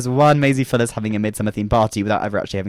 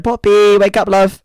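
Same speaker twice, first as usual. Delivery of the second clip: higher, louder wake-up call; calling to wake him; 7 semitones higher, 4 dB louder, slower than usual. Retold or imitated. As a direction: call across the room. Calling sleeping boyfriend awake.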